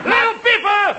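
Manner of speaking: high shouted cowboy call. Pretend. Comic playing cowboy hero, shouted call. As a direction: holler it across the prairie. Calling out like Red Ryder.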